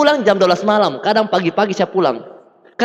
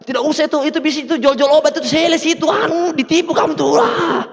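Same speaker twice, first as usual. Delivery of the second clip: scolding mother; 4 semitones higher than usual. Retold or imitated.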